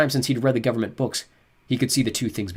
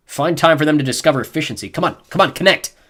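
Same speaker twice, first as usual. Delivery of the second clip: ordinary delivery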